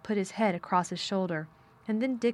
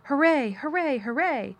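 mock cheering shout; 7 semitones higher, 5 dB louder than usual. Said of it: "Colin would probably have shouted".

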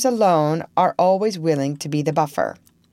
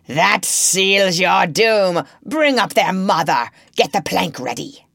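roaring; 3 semitones higher, 5 dB louder than usual. A narrator's reading. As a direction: bellow the doom to the whole deck. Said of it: "and Hook roared out".

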